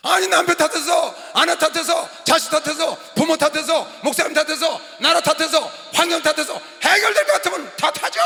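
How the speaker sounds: high, shouted list in short bursts; full-force preaching. Acted. Pastor listing everyone people blame, shouted preaching. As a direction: hammer each item at the congregation.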